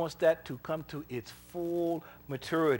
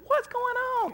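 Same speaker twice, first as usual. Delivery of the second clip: much higher, startled; shocked question; 5 dB louder, slower than usual. Retold or imitated. Counselor shocked by the boss's demand, much higher. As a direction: disbelief leaping up in pitch.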